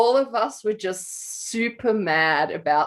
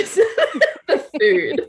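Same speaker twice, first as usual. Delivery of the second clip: laughing through the words, pitched way up; 8 semitones higher, 5 dB louder than usual. Real, not acted. Teasing the co-host about food, laughing. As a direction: giggle the punchline out.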